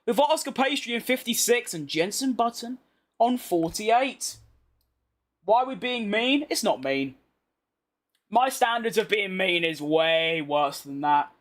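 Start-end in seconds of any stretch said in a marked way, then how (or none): none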